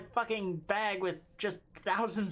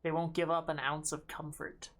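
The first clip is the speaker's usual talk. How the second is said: normal talking voice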